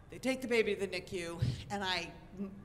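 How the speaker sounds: ordinary talk, nothing marked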